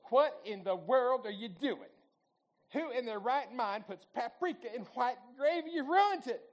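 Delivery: indignant Southern wife, loud and high-pitched; loud Southern accent. Retold or imitated. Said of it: "this loud voice in a Southern accent".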